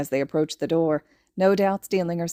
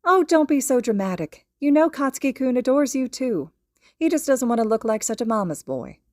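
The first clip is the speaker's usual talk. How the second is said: lightly higher, gently teasing mom voice; a hint of a fond mother laughing it off; mother on the phone; 6 semitones higher, 3 dB louder than usual. A narrator's reading. Mother teasingly reassures her friend on the phone.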